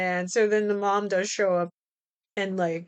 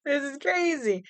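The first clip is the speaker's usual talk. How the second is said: mock disbelief; 4 semitones higher than usual. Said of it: "I'm like".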